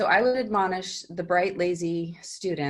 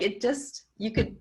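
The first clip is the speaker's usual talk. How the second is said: ordinary delivery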